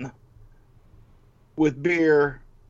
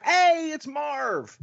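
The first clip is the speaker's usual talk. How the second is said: excited; much slower than usual. Retold or imitated. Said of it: "which I was like".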